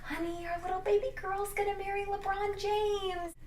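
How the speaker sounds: gushy mock-mom voice, soft and way up high; excited mom. Mom gushing to her daughter about the wedding, mock excited squeal. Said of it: "your mom gets all excited".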